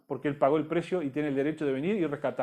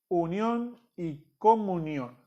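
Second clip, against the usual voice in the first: slow dictation; much slower than usual. Shown as a demonstration. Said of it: "dos palabritas para completar en el manual".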